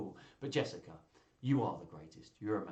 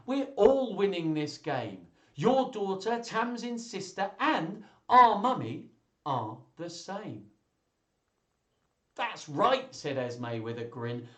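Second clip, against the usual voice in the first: put-on high, loud, animated reading to children; story read-aloud; 6 semitones higher, 7 dB louder, slower than usual. Reader voices children agreeing Jessica's the greatest, exuberant storytelling. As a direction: build emphatically to the happy ending, stretched and high.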